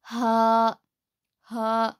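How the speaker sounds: हा from the lowest throat; Arabic ha. Shown as a demonstration. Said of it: "वो हा है".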